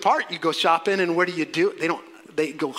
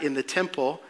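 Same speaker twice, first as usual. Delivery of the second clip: usual speaking voice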